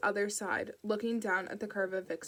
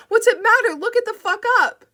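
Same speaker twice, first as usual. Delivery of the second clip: much higher and louder, screaming in rage; mother losing it at the dispatcher, all-out yell; furious scream; 13 semitones higher, 15 dB louder than usual. Acted. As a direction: scream it down the phone at her.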